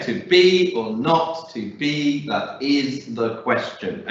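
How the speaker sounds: "be", "not", "is" and "ques" stressed on every second syllable; to BE or NOT to BE, that IS the QUEStion; teacher models the da dum beat, slowing at the end; strict iambic stress. Shown as a demonstration. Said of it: "Yes, good".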